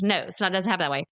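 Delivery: usual speaking voice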